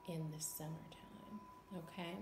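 soft unvoiced whisper; hushed teacher aside. Real, not acted. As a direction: drop to a whisper to close. Teacher softly wraps up message.

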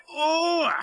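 squealing, high-pitched "oh" of strain; high strained groan. A narrator's reading. Scruffy pulled by Oliver's great heave, high groan. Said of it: "groaned Scruffy".

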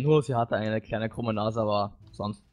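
ordinary talk, nothing marked

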